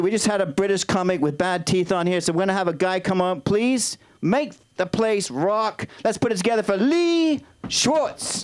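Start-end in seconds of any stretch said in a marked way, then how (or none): 3.4-8.4 drawn-out announcer call, rising high; big, slow stage intro; MC introduction. Real, not acted. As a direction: stretch the words to rouse the crowd. MC brings up the next comic.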